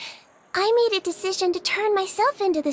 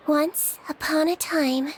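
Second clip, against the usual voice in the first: fairy tale narration; 5 semitones lower than usual. A narrator's reading. Doctor telling friends her own story as a fairy tale, gentle narration. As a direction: read it like a once-upon-a-time tale.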